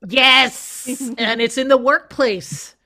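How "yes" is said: loud, high, repeated bursts of agreement; emphatic shouted yeses. Real, not acted. Co-host backing the point to laughter, emphatic shouting. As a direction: cheer each yes out separately.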